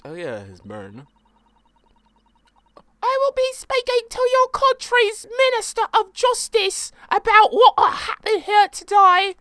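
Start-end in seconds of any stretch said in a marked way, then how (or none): 3.1-9.4 very high, loud posh English lady; posh English threat. Acted. Threatening the judge with her minister, very high, louder posh English voice. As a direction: threaten like an offended duchess.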